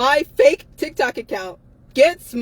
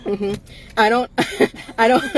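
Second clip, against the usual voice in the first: ordinary delivery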